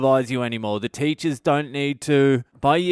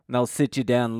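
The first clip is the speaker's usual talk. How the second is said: usual speaking voice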